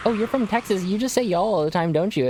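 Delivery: mock teasing coworkers. Coworkers ribbing the streamer about Texas, mock teasing high. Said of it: "people are like".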